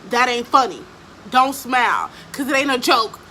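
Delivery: loud, punchy mimicked telling-off; stern scolding. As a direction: act out a stepmom laying down the law. Stepmom scolds her for smiling.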